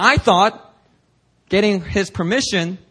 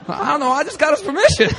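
baffled innocence; 10 semitones higher, faster than usual. Retold or imitated. Retold confused self reply.

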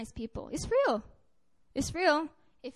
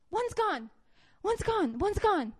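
retold woman's cry; 7 semitones higher, 4 dB louder, slower than usual. Demonized woman voiced in story.

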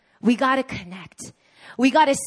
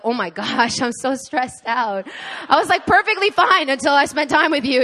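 stressed-out exclamation; 3 semitones higher, 6 dB louder than usual. Retold or imitated. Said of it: "you're like".

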